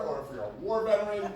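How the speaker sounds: normal talking voice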